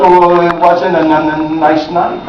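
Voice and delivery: put-on stuttering girl. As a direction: stumble on the n sounds. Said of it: "But stutter jokes".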